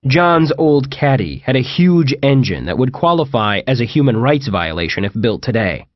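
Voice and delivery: ordinary delivery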